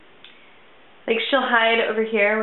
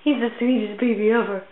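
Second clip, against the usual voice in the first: ordinary talk, nothing marked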